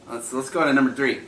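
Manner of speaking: ordinary delivery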